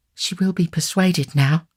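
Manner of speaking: high, slow hopeful cry; eager cry. A narrator's reading. Young lady hoping Nancy will agree, eager cry. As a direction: urge her hopefully. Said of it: "cried the young lady".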